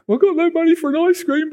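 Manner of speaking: put-on high little-boy voice; child's thought. Four-year-old at the ice cream van, high child voice. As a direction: think it like a small hopeful child.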